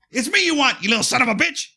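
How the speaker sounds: worked-up retelling of a hero's sacrifice; emotional hero line. Retold or imitated. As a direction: offer yourself up, voice straining high. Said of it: "You are not getting emotional again".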